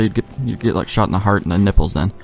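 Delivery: ordinary talk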